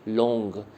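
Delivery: slow model reading of लॉन्ग; vowel demo. Shown as a demonstration. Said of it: "इसमें ओ की sound है".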